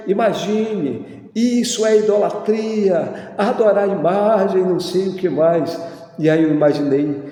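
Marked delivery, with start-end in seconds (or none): 0.1-5.8 high-pitched, imitated indignant railing; mock scolding. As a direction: mimic churchgoers cursing at a procession. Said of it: "se direcionando até a porta para xingar".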